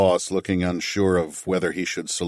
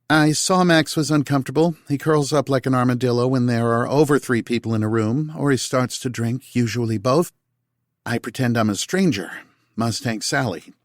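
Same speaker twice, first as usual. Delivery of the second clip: higher, amused woman explaining; Tal's voice; 5 semitones higher than usual. A narrator's reading. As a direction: tease her husband fondly. Tal explains the game.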